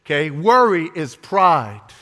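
ordinary talk

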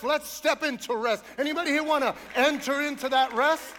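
impassioned full-force sermon; fired-up preaching. Real, not acted.

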